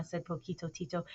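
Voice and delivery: ordinary delivery, nothing marked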